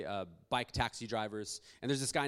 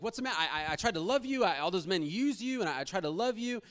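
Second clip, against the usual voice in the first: higher and louder, anguished plea; hurt pleading; 8 semitones higher, 5 dB louder than usual. Retold or imitated. Man pleading with the woman who turned on him, anguished. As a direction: beg her to understand, hurt.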